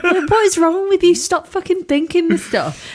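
high exasperated self-telling-off; scolding herself. Retold or imitated. Telling herself off for dwelling on sad thoughts, exasperated.

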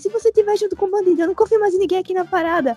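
high-pitched rant, without full volume; retold yelling. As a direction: re-enact telling a woman off. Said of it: "Eu gritei com a mulher".

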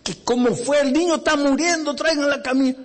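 high, pressing demand; desperate plea. Acted. Driver pressing the receptionist, high and desperate. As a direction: push past the questions to save the boy.

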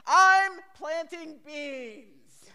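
put-on high, loud, long-drawn kid's reply; imitated child's indignant holler; little brother hollering back. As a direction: stretch every word out in a far-off shout. Little brother yelling back from planter.

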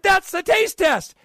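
high-pitched, drawn-out retold exclamation; delighted approval. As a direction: exclaim it like finally getting the proper thing. Retelling his delighted approval of sample.

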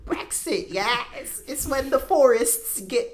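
high airy voice, lightly done; breathy high parody; mock clueless islander. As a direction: imitate the girl confusing Brexit with trees. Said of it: "this one chick thought it was".

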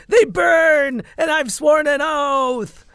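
high, loud anguished wail; wailing cry. Acted. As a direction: cry it out in pain, slowly. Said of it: "turns suddenly into a wailing cry".

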